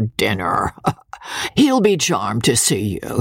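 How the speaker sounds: put-on old country lord greeting guests with a cackle; coarse old baronet. Old baronet teasing his son's wife, leering. Voices old Sir Pitt.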